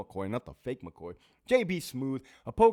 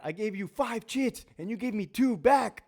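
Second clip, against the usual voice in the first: high put-on snippy officer voice; central control voice; 11 semitones higher, 5 dB louder than usual. Control officer snapping at inmates over chits, high whiny voice. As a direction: whine like a fed-up control officer. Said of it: "the voice that they use in the central control window".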